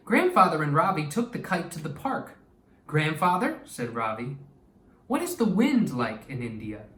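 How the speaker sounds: expressive read-aloud storytelling voice; story narration. Acted. Teacher reading a story to her class, lively and expressive. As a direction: read it like a picture book to young kids.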